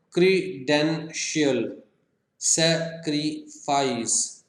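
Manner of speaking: क्रीडेंशियल and सैक्रिफाइस slowly, in three separate beats each; three-syllable split. Shown as a demonstration. Teacher counting three syllables, क्रीडेंशियल and सैक्रिफाइस broken up slowly. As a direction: chop क्री-डेन-शियल, सै-क्री-फाइस with pauses. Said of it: "three syllables का है".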